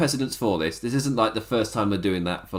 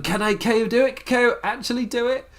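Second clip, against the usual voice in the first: much higher, excited mock commentator yell; imitating a screaming commentator; 12 semitones higher than usual. Commentator hyping the challenger's charge, much higher excited mock scream. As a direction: hype it up like a commentator losing it. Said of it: "the commentator's screaming".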